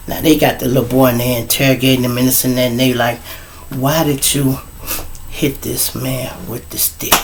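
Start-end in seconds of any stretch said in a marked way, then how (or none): 3.7-7.1 slightly hushed, measured questioning; interrogator. Retold or imitated. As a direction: question a child quietly, pausing mid-sentence. Quoting an interrogation.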